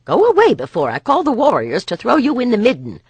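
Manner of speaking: angry guard. Acted. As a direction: threaten him out of the doorway. Nessa drives off a man.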